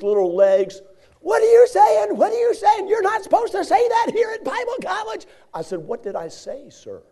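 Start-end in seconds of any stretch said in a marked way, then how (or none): 1.2-5.2 high, loud, squeaky yelling professor; yelling little professor. Retold or imitated. Little professor yelling at the cursing student. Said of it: "he was yelling at me". 5.8-7.0 timid reply. Retold or imitated. Timid reply to yelling professor.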